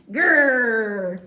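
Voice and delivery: tiger growl. Acted. Small tiger growls at the mouse, mock growl. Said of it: "a bit more grrr".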